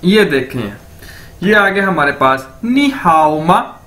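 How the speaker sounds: ordinary talk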